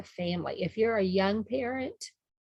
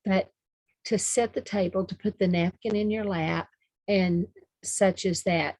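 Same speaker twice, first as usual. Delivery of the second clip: usual speaking voice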